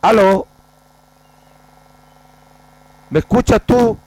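high, loud shout for the caller; shouting into the phone. Real, not acted. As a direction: yell so he hears the question. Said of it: "Dice que nos escucha bajito".

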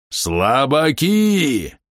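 contemptuous battle roar from a mad swordsman; mad swordsman's roar. A narrator's reading. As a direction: roar it out slowly, stretching every vowel. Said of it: "проревел безумный мечник".

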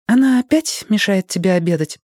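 put-on high strict child's voice; a stern little schoolgirl; stern little girl. A narrator's reading. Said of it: "Строго сдвинув брови".

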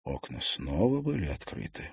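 usual speaking voice

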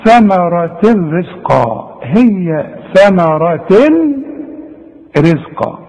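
ثمرة رزقا recited slowly, the tanween of ثمرة merged into the ra of رزقا with no nasal hum; slow Quranic demo of ثمرة رزقا with full idgham; merged recitation. Shown as a demonstration. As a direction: draw ثمرة out slowly and slide straight into رزقا. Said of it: "تعمل إيه؟".

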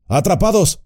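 high, alarmed cry; shout. Acted. Gandalf realizing the company is trapped, high alarmed shout. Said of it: "gritó Gandalf".

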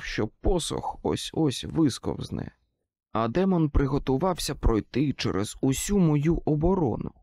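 usual speaking voice

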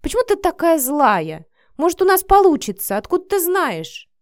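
loud, high-pitched protest; upset protest. A narrator's reading. Vika argues with her doubting friend, loud upset protest. Heated quarrel between two friends.

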